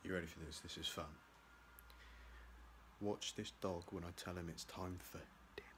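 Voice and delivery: hushed whisper, almost no voice. Real, not acted. Whispering to viewers so the dog won't hear. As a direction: keep it secret from the dog, whispered.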